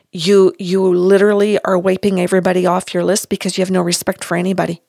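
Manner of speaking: normal talking voice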